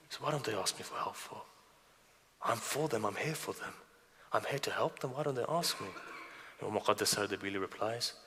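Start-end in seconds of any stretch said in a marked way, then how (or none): 0.1-5.9 soft, understated lament; hurt pleading. Acted. Upset Imam lamenting that his followers don't ask, softly pleading. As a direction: plead quietly, hurt at being forgotten.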